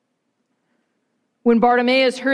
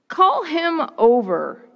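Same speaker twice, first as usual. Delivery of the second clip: calling over; 6 semitones higher, slower than usual. A narrator's reading. Jesus summons the blind beggar.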